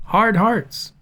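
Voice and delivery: "hard hearts" both stressed evenly, the pitch lifted; two equal heavy beats on "hard hearts"; spondee. Shown as a demonstration. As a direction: give "hard" and "hearts" the same weight. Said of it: "has been changed to a spondee".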